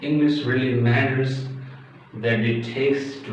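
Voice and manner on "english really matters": ordinary talk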